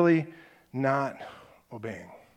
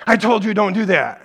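loud angry repeat; dad yelling; 6 semitones higher, 10 dB louder than usual. Retold or imitated. Dad shouting again at the hitting kid, loud. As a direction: bark it louder this time.

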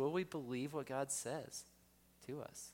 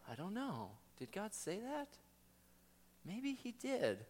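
waver between yes and no; doubting voice; 7 semitones higher, 5 dB quieter than usual. Acted. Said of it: "the voice twists it".

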